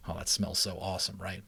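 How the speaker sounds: plain reading voice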